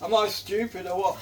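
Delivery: ordinary talk, nothing marked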